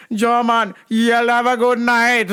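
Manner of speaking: high, loud, slow Jamaican goodbye; mock Jamaican accent. Guard wishing him good night in a Jamaican accent.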